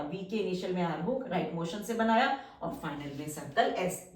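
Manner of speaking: usual speaking voice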